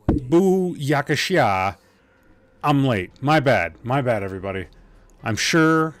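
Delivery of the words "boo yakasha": slow goofy made-up word; silly put-on voice. Streamer blurting a silly word to chat, goofy drawl. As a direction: drawl it like a cartoon. Nonsense exclamation.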